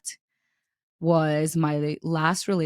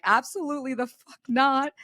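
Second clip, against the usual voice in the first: a higher, clipped flat refusal; retold outrage; 8 semitones higher than usual. Finding texts on his phone, a mock outraged refusal. Retold discovering cheating messages.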